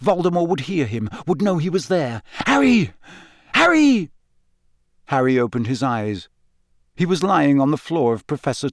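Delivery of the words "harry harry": high, loud cries of a name; urgent calling. Acted. Friend waking Harry from his nightmare, urgent calling. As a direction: call out to rouse him. Classmate calls to wake Harry.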